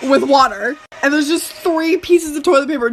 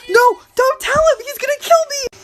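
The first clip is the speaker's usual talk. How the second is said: freaking out; 8 semitones higher than usual. Retold or imitated. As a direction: imitate a panicked friend pleading. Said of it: "my friend starts freaking out".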